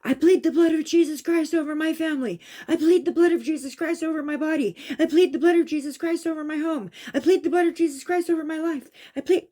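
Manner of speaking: loud, high, urgent pleading prayer; screaming a prayer. Retold or imitated. Recalling fighting off a spirit, screamed urgent prayer. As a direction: plead the blood like a desperate shout. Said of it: "And then I started screaming".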